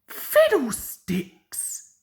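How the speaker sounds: high, slow, airy scoff; dismissive Mavis. A narrator's reading. Mavis scoffing at Toby before flouncing off. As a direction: brush him off in a high sniffy voice. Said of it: "said Mavis and flounced away".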